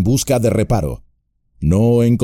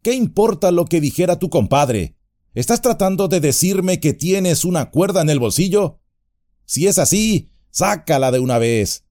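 high, teasing impatience; amused irritation; 7 semitones higher than usual. A narrator's reading. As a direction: tease him, half annoyed. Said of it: "divertido e irritado a la vez".

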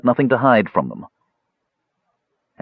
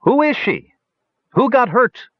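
very high, eager questions; curious crowd clamouring for news; 3 dB louder than usual. A narrator's reading. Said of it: "several curious elves asked at once".

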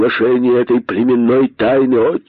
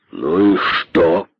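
low, slow dismissal; dismissive interruption; 4 semitones lower, much slower than usual. A narrator's reading. Great python interrupts Косой, dismissive. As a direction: cut in with heavy, drawn-out indifference. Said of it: "перебил его великий питон".

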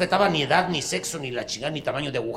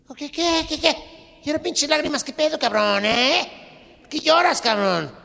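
high mocking punk voice; 13 semitones higher, 3 dB louder than usual. Punk mocks crying old man.

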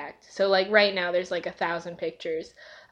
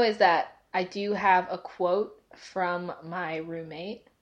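usual speaking voice

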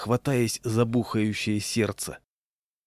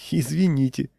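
higher-pitched tittering apology; giggly; 4 semitones higher than usual. A narrator's reading. Walker apologises to patrolman for the wrench, giggly. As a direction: apologise with a slight nervous titter. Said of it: "хихикнул я".

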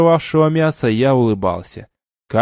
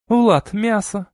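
calling out; 9 semitones higher, slower than usual. Acted. Dunyasha calling from kitchen.

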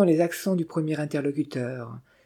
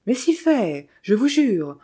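protesting; 6 semitones higher, 4 dB louder, slower than usual. A narrator's reading. Morbihan protests to the doubting young woman, insistent. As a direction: pitch jumps higher in earnest protest. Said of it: "protesta de Morbihan".

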